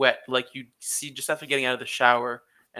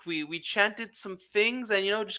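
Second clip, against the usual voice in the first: high nervous cultist; 7 semitones higher, faster than usual. Acted. Cultist explains ritual plan.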